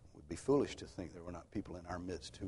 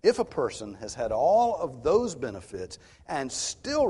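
loud, slow and higher, hammering each phrase; preaching at full force; 6 semitones higher, 11 dB louder, slower than usual. Acted. Preacher to his congregation, pressing the warning, loud and slow. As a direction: preach it out to the back pews, slowly.

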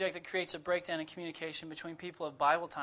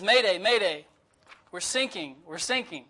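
mayday call; 7 dB louder than usual. Retold or imitated. Distress call over the intercom.

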